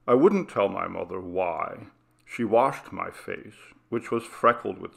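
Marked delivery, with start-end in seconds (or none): none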